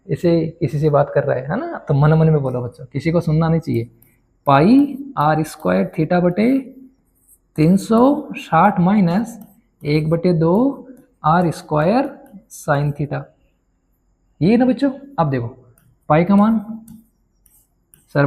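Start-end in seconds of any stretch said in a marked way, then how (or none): 4.5-13.2 slow dictation. Shown as a demonstration. Dictating formula to class.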